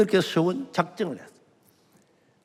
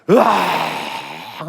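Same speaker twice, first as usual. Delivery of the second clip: drawn-out, louder wail of "으아"; long wailing cry; 5 semitones lower, 5 dB louder, much slower than usual. Retold or imitated. Boy bursting into tears at dawn, long loud wail. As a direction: let the sob stretch out slow and loud. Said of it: "울면서".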